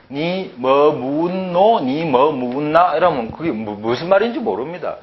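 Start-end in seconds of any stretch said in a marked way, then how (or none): 0.1-1.8 drawn-out, even-toned Seoul reading of "니 뭐 묻노"; exaggerated slow Seoul intonation; Seoul accent. Shown as a demonstration. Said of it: "서울 사람 이건 모르면". 1.8-2.9 drawn-out, even-toned Seoul reading of "니 뭐 묻나"; exaggerated slow Seoul intonation; Seoul accent. Shown as a demonstration. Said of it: "서울 사람 이건 모르면".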